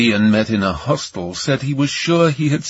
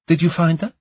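lightly voiced woman, higher pitch; woman caller; 6 semitones higher than usual. Camellia asks Ion by phone about his search, woman's voice. Female caller voice in audiobook dialogue.

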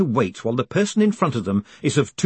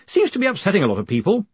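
higher, quick voice over the din; lightly raised, hurried character voice; 8 semitones higher, faster than usual. A narrator's reading. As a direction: call out quickly across a noisy buffet. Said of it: "raising his voice above the din".

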